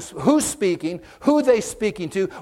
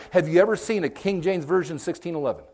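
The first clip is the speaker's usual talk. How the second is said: ordinary talk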